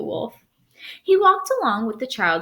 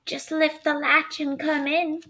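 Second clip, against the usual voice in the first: higher put-on old woman's voice; wolf faking granny; 7 semitones higher than usual. Wolf posing as grandmother lures the girl in. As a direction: lure her in sweetly.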